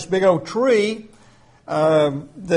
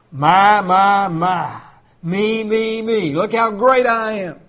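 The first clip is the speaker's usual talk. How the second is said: self-important, crowing king; hammed-up self-admiration; mock boasting; slower than usual. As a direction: gloat over every word about yourself. Mocking the king's self-centred boasting.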